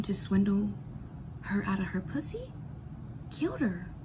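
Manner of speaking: low ominous whisper; whispered like a dark secret. Real, not acted. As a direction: lean in and whisper it darkly.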